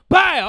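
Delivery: loud high bam; slam sound. Retold or imitated. Imitating mom slamming dad with a loud bam. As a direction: shout bam like a body hitting the floor.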